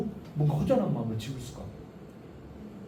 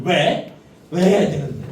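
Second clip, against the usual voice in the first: put-on child arguing back; 8 dB louder, slower than usual. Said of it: "따지잖아요".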